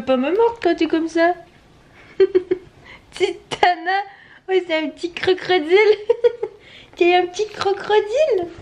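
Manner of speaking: playful pet talk. Real, not acted. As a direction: tease the nipping pup sweetly.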